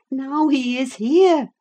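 high, loud cry of warning; alarmed exclamation. A narrator's reading. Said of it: "I exclaimed".

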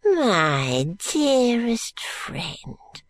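very slow, high, swooping endearment; oily old man's coaxing; wheedling old man. Acted. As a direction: draw out the flattery.

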